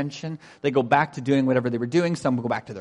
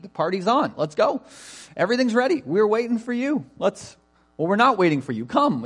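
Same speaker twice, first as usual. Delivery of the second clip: higher-pitched, eager urging; emphatic servants; 8 semitones higher, 3 dB louder than usual. Retold or imitated. Preacher voices servants urging guests to come, emphatic. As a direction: hurry the guests along insistently. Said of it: "they're a lot more emphatic".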